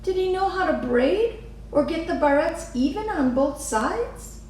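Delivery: put-on a young girl puzzling it out; curious little girl. A narrator's reading. Lily asks Mama.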